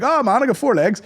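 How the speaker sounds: high mock clown patter; hammed-up children's clown. Clown doing his act: high mock patter. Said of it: "he's like".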